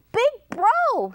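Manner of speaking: high-pitched little mouse warning; high, dramatic mouse voice; storybook mouse. A narrator's reading. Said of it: "said the mouse".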